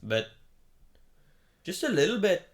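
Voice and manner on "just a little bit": a light mock Irish lilt, pitched higher; a playful Irish-sounding lilt; a hint of an Irish accent. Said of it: "sounded like some kind of Irish".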